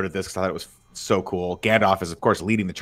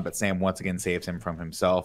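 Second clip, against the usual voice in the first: ordinary delivery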